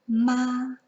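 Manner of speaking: "Ma" held high and level, no inflection; flat first tone. Shown as a demonstration. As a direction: keep "Ma" flat across on a high pitch. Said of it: "It is flat across and literally just spoken out".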